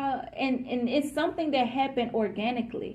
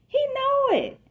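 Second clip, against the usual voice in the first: jumps way up high on He know it; high insistent; 4 dB louder than usual. Shown as a demonstration. Reader to the listener, stressing that he knows, high and insistent. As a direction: sing it out high to really sell it.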